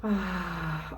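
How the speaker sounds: soft, low, falling "ahh"; pleased sigh. Real, not acted. Savouring a delicious fresh scent, soft pleased sigh. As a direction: sigh softly with real pleasure. Savouring a perfume she loves.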